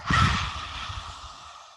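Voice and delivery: forceful breathy roar exhale; lion's breath exhale. Shown as a demonstration. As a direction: huff out hard like a lion. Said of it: "This is lion's breath".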